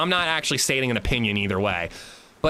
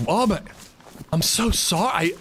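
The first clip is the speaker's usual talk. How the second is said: higher, slowed, exaggerated apology; mock apologetic confusion; 5 semitones higher than usual. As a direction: imitate someone playing confused. Acting out confused seat thief.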